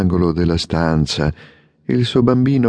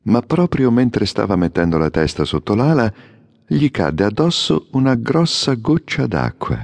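plain reading voice

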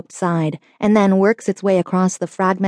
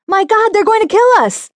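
high, loud panicked scream; terrified scream; 5 dB louder than usual. A narrator's reading. Passenger screaming in terror under rocket fire. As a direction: scream it like death is coming. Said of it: "The passenger screams again".

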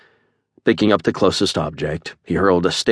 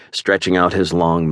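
plain reading voice

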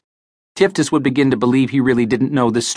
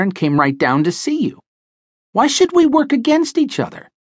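higher, quick, indignant small-time crook; chipper bantam hustler; 7 semitones higher than usual. A narrator's reading. Tiftus talking fast to Parker to explain his arrival, indignant and chipper. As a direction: rattle it off like a cocky little man defending himself.

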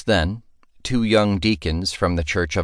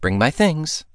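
higher haughty command; mock-regal order; 9 semitones higher than usual. Princess snapping fingers at her friend, mock-bossy. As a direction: snap out a playful order.

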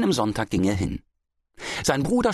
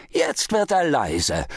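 slow, high triumphant roar; drawn-out jeering bellow; 7 semitones higher, slower than usual. A narrator's reading. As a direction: crow over the cowed opponent. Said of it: "brüllte der Händler".

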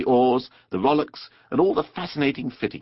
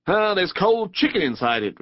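higher-pitched, offhand rat voice; brief reply; 7 semitones higher, 3 dB louder than usual. A narrator's reading. Rat tells Mole the basket's contents, offhand. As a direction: toss off the answer. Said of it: "replied the rat briefly".

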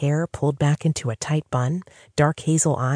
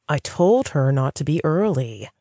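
usual speaking voice

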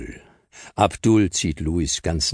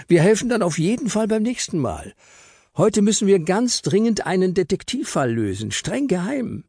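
put-on higher, coaxing little girl voice; pleading girl Lotta; 13 semitones higher than usual. Lotta begs Papa to let them go, coaxing and high. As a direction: sweet-talk Dad into saying yes.

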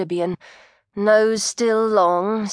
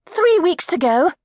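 bright, higher, chipper reply; forced brightness to the nosy coat check lady; brightly; 10 semitones higher, 4 dB louder than usual. Acted. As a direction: put on a cheery front. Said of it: "said Maggie brightly".

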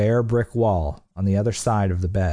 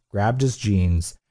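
ordinary delivery, nothing marked

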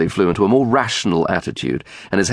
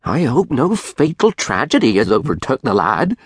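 anxious grandfather; 4 semitones higher than usual. A narrator's reading. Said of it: "said his grandfather".

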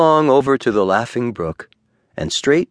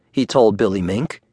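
plain reading voice

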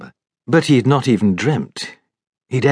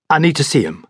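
higher, quick and firm; prompt doctor; 6 semitones higher, faster than usual. A narrator's reading. Doctor insists to the servants, promptly. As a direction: insist at once. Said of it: "Clouston said promptly".

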